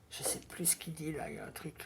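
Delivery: whispered aside to herself; whispering. Real, not acted. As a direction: drop to a whisper. Aside while trying to remember.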